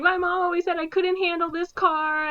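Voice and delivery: high, whiny mock lament; whiny son. Ben Stiller whines about his mom, high and whiny. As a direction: pout like a hurt little boy.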